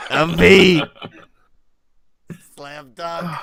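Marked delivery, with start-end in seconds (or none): 0.1-0.8 loud hammed-up mock impression; Andy. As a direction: announce the bad impression proudly and loudly. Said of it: "an impression of Andy doing an impression".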